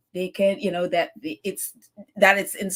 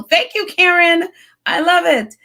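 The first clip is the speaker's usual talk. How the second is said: gushing thanks; 9 semitones higher, 8 dB louder than usual. Real, not acted. Thanking a caller warmly.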